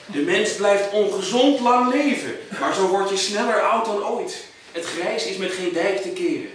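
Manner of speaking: declaimed closing verse, landing each command; emphatic poem finale. Acted. Poet calling on the audience to crucify every planner, emphatic recital. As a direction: build to a slow, stressed last line.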